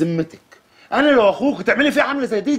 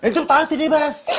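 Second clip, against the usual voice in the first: laughing; 4 semitones higher than usual. Acted. يلاطف زوجته الباكية ضاحكا.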